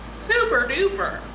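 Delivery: a goofy high-pitched "Super duper"; playful squeaky "Super duper"; silly high super duper. Real, not acted. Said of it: "that's not how I talk".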